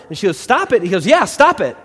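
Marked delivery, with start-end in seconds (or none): 0.4-0.8 high, loud startled echo. Acted. Client echoes therapist's unexpected advice back, startled. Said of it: "her response is startled". 1.1-1.8 high, loud blunt confirmation; insistent shout. Acted. Therapist confirms his two-word advice to client, loud and insistent.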